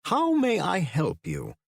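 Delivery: a courteous English professor greeting visitors; English accent. A narrator's reading. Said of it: "in a sophisticated English accent".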